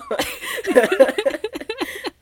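high bursting laugh; loud laugh. Real, not acted. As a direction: let a big laugh burst out. Laughing at own quoted complaint.

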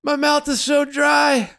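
high, drawn-out dying wail; mock crying. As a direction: whine for help like a wounded man. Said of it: "like, crying".

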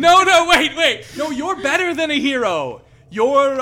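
flustered high plea; urgent plea. Acted. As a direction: stop them leaving, flustered. Zell begs them stay.

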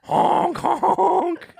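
high creepy clown honks; silly clown. Pretend. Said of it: "That was so creepy".

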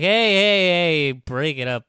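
retold calling out. Vampire interrupting two fighters, retold calling out. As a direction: call out lightly to break up a scuffle.

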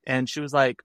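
usual speaking voice

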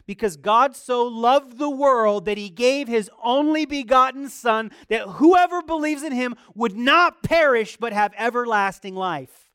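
loud, high fervent preaching; preaching at full force. Acted. Preacher declaring John 3:16 to the congregation, loud and fervent. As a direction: proclaim it to the whole church.

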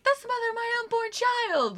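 high, hammed-up outraged Peter voice; put-on high indignant voice; mock Peter. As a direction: squeak it out like a wounded boyfriend. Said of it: "he's like".

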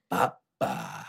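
very deep, soft growled "Papa"; deep rapper growl on "Papa". Retold or imitated. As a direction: drop the voice way down and rumble "Papa".

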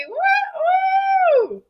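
long, very high whoop; high, held cheering "woo"; excited woo-hoo cheer. Real, not acted. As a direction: whoop in welcome for the guest. Cheering the guest's entrance.

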